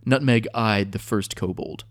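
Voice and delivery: usual speaking voice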